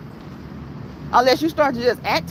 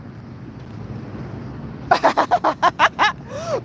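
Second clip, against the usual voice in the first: high, wheezing real laughter; tearful laughing fit; 9 semitones higher than usual. Real, not acted. Streamer tearing up at chat's jokes, helplessly laughing. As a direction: laugh until tears come. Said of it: "I'm tearing up".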